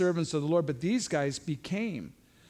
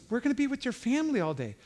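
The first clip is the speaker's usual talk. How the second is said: wife's quick reminder; 8 semitones higher, faster than usual. Retold or imitated. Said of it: "Kimberly goes".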